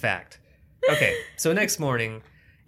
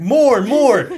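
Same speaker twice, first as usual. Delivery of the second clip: much higher, louder eager shout of more; mock director shout; 12 semitones higher, 14 dB louder than usual. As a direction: yell it like a hyped director.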